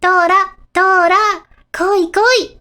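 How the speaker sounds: loud, high-pitched urgent call. Acted. Character calling Tora to come over, calling out loudly.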